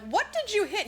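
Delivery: high-pitched retold query; acted-out alarm; mock shocked question. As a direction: ask it high, as if startled. Rich couple questions driver.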